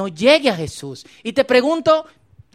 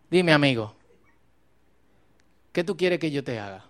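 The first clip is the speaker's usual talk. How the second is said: gentle question; 8 semitones lower, 5 dB quieter than usual. Acted. Jesus gently asking the beggar.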